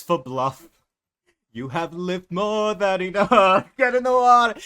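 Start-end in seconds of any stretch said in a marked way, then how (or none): 1.5-4.6 a sinister lure that ends in a laugh; menacing siren. Acted. Bianca threatening Argus into the water, loud eerie taunt. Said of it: "That's kind of what she's like".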